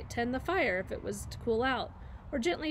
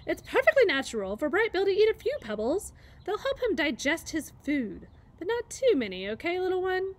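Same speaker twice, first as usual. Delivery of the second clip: higher, gently amused goose voice; kindly old goose; 7 semitones higher, 5 dB louder than usual. A narrator's reading. Goose reassures the robot about the pebbles, kindly amused. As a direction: explain it fondly like a wise old goose. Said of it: "said the goose with a laugh".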